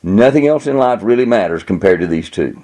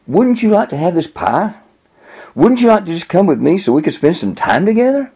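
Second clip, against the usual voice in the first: put-on higher-pitched voice for a girl; inviting girl; 8 semitones higher than usual. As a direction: coax him in a lightly acted girl's voice. Cute girl tempts boy in story.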